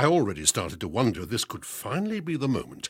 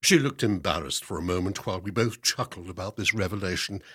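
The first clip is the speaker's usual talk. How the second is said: plain reading voice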